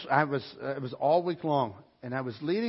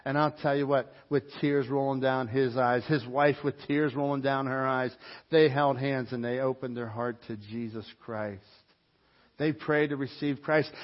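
usual speaking voice

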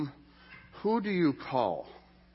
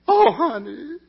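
"Oh, honey" much higher and louder, cooing; gushing mom imitation; 10 semitones higher, 9 dB louder, slower than usual. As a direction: crank up the sympathy on "Oh, honey". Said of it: "Moms are like".

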